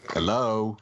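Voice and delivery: drawn-out call down a dead line. Real, not acted. Cut-off podcast guest to co-hosts, calling out. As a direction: check if anyone is listening. Checking whether the others can hear.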